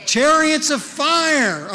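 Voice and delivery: Chariots of fire said high, loud and slow; grand proclamation. Shown as a demonstration. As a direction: draw out Chariots of fire like a fanfare. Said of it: "Isn't it good I got to practice".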